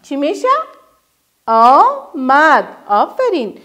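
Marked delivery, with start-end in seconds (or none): 1.5-2.7 کشیده و آهسته; drawn out slowly. Shown as a demonstration. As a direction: stretch the word very slowly. Teacher modelling syllables.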